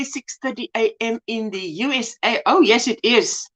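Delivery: ordinary delivery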